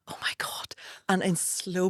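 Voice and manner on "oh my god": breathless whisper of wonder; hushed mock reverence; whispered awe. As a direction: whisper it as if you're stunned. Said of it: "And I went".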